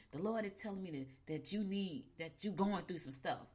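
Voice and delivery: hushed, lightly voiced preacher lady; a soft hint of a church mother prophesying; pastoral voice. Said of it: "in her pastoral voice".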